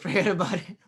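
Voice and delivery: put-on teasing a friend, mild New York accent; mock New York. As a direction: echo it like a Brooklyn wiseguy. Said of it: "That's what New Yorkers".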